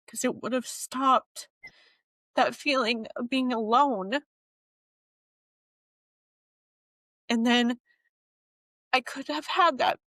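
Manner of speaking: holding back real tears; on the verge of tears. Real, not acted. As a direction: voice catching with emotion. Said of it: "I could get really emotional right now and cry".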